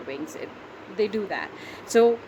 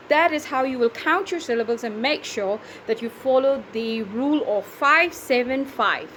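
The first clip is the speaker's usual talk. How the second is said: ordinary talk, nothing marked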